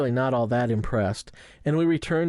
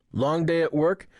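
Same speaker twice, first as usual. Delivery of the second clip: put-on Russian accent; 5 semitones higher than usual. A narrator's reading. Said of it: "the driver asks in a Russian accent".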